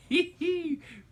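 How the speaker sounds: high burst of real laughter. Real, not acted. Teacher laughing to the class about eating all the wings. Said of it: "We laughed".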